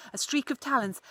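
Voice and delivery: ordinary delivery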